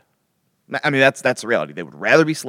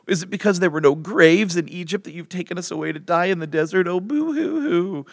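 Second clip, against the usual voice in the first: whiny voice; 9 semitones higher, 4 dB louder than usual. Acted. Israelites complaining to Moses in the desert, mock whiny. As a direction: whine it like a hammed-up crybaby. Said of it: "I have to do this in a whiny voice".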